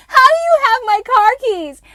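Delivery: shrieking yell. Retold or imitated. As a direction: scream the question in utter shock. Repeating the shocked question.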